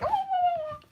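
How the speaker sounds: a high squeaky surprised "Oh". Retold or imitated. Spotting her friend in the car, a high surprised oh. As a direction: jump up high, caught off guard.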